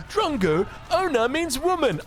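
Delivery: high, drawn-out mimicry of a complainer; mock pedantic viewer. Caster imitating viewers correcting him, mock pedantic. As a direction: imitate a nitpicking commenter correcting you. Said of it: "you guys are gonna say".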